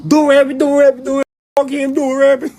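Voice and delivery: loud, very high mock voice; mimicked egging-on. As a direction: yelp it like an overexcited goader. Mimics rappers egging each other on.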